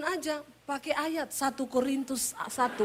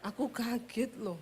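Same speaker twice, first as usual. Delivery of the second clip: soft Solo husband; 11 dB quieter than usual. Retold or imitated. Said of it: "nadanya turun terus".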